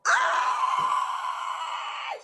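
high unvoiced scream of disbelief, loud; shrieking cry of exasperation. Real, not acted. Telling listeners how the cashier pushed her: high exasperated shriek. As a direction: let out a sharp, airy shriek.

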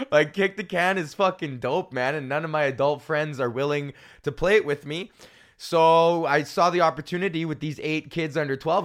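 usual speaking voice

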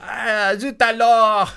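a hammed-up French exclamation, loud at the end; mock French. Joking to a friend in a hammed-up French accent.

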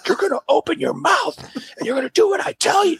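high, strained angry order; imitated screaming dentist. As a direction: act out a furious dentist yelling at a child. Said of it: "he's screaming at me".

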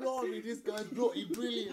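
laughing mock British praise; British accent. British fan praising the comic, laughing British accent. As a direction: gush like a Brit in the audience.

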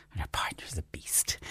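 sudden stage whisper for the clue. Acted. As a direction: drop to a secret whisper. Dramatic clue ending in whisper.

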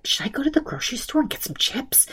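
unvoiced, soft worried whisper. [name] whispering to her husband Justin about chips, whispered. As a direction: whisper it aside to your husband.

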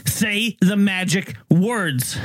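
slow, drawn-out command, each word spaced; repeated command. Retold or imitated. Storyteller quotes the man repeating his demand, slow drawn-out command. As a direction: imitate him repeating it very slowly. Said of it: "So he repeats himself".

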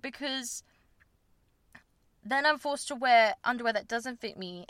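normal talking voice

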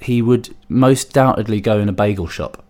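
ordinary talk

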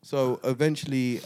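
normal talking voice

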